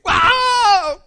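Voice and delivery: a very high, loud cry of wonder; amazed cry. Retold or imitated. Healed woman hearing suddenly, very high amazed shout. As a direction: burst out in astonishment.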